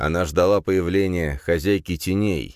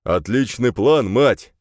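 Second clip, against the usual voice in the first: growling; 5 semitones higher, 5 dB louder, slower than usual. A narrator's reading. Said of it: "рыкнул Рин".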